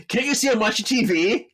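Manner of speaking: high, loud, irritated retort; annoyed snap. Retold or imitated. Phoebe snapping at Piper.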